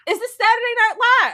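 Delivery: high, loud baffled question; mock disbelief at the skit; incredulous. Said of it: "I was like".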